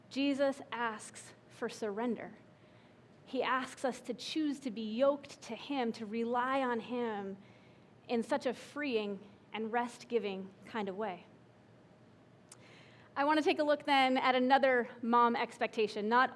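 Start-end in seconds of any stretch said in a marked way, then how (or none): 0.1-11.2 soft sincere refrain. Acted. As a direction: hush it down tenderly. Repeated refrain, softened.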